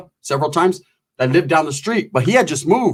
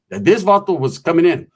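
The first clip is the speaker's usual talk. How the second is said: ordinary talk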